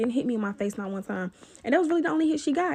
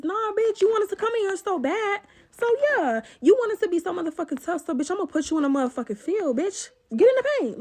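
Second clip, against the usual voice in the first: heated taunt; 7 semitones higher than usual. Retold or imitated. Yelling at her during the fight.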